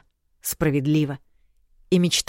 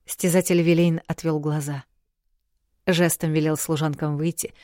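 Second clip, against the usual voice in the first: usual speaking voice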